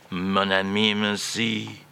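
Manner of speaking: hammed-up French accent, slow; echoing French accent. [name] performs phrase again, slow French accent. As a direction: stretch it out dramatically in French accent. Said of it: "this is echoingly brilliant".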